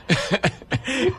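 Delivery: high, light laugh. Real, not acted. Guest laughing along with the hosts, light laugh. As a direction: laugh at the image.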